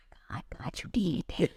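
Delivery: hushed, amazed thanks to God; awed whisper. Retold or imitated. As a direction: breathe it out, stunned by the gift. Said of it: "I was just like".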